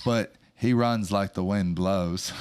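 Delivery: put-on slow Southern Forrest Gump accent. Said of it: "That was a good accent".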